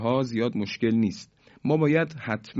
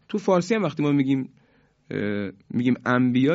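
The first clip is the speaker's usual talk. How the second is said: ordinary delivery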